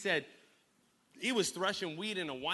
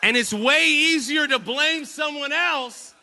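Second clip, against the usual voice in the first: high, loud, drawn-out proclamation; emphatic preacher shout; 10 semitones higher, 13 dB louder, slower than usual. Real, not acted. Preacher confronting the church about blaming others, emphatic shout. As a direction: shout it slowly to drive it home. Preacher driving home his point.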